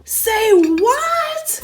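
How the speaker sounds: high, loud outburst of disbelief; stunned say what. Acted. Wife hearing brother-in-law saw the dolls, shocked. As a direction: blurt it out in shock. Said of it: "Let's pause again".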